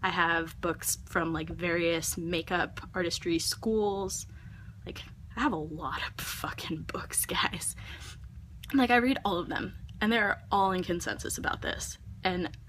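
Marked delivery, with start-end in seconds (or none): 4.8-7.8 whispered aside. Real, not acted. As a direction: lean in and whisper it.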